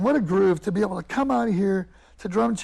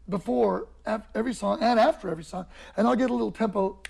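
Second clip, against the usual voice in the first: ordinary talk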